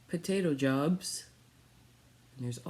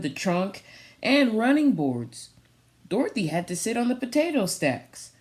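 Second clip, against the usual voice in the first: storybook reading; 2 semitones higher, 6 dB louder than usual. Acted. Reads picture book aloud.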